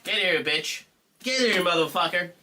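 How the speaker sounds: loud, playful call to the dog. Real, not acted. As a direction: summon the dog with a rough shout. Calling the dog loudly.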